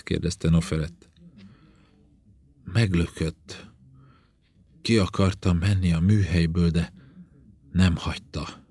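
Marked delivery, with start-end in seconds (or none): 2.6-8.6 halting, understated hurt; slow, painful speech. A narrator's reading. Burned princess telling her doctor what happened, haltingly, pained. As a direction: let the words come out haltingly, with long pauses. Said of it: "A szavak lassan, fájdalmasan törtek elő belőle".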